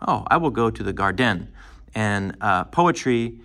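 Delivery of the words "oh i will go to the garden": garden ending on a stressed DEN, gar-DEN; mock sentence landing on gar-DEN in garden; mock wrong stress. Shown as a demonstration. Teacher mocking the wrong accent in a sentence, garden as gar-DEN. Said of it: "we don't say garden".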